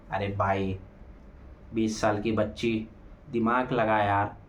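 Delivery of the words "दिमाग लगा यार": ordinary delivery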